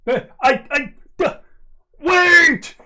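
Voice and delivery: high, loud, stammering call to wait; hesitate, then cry out wait; frantic call. Acted. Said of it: "and he's like".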